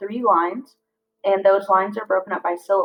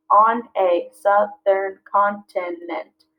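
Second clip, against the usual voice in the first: slow, each syllable spaced out; slow syllable-counting read; much slower than usual. Shown as a demonstration. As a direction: clap out each beat. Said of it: "seven syllables".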